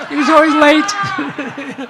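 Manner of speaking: drawn-out laugh through words. Real, not acted. Singer joking to audience about late bandmate, laughing. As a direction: laugh while saying it, slowly.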